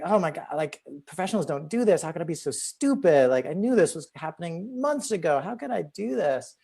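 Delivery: high-pitched mock panic at oneself; self-scolding. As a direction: beat yourself up in a high voice. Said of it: "like, screaming at myself".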